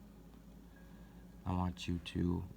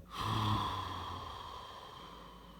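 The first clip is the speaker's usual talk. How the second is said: long, audible exhale that slowly fades; big sigh; 3 dB quieter than usual. Shown as a demonstration. Meditation guide showing listeners how to let go, big sigh. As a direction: let it all go in one big breathy sigh. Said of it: "letting go with a big sigh".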